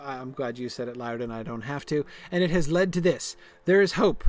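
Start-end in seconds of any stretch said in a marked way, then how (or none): 2.3-4.2 higher, louder, declaiming the line; dramatic quest reading. Acted. Voicing Lyrdon's quest text, stern and declaiming. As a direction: read it like a stern hero. Reads character's line aloud.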